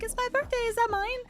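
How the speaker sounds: put-on high little-kid insistence; insistent toddler. Toddler insisting the present is hers. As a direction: insist like a small child. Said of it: "she was like".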